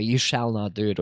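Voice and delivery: low, firm forbidding tone; stern command. Shown as a demonstration. Repeating the forbidding shall for chat, low and firm. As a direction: forbid it flatly. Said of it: "if you say it like that way".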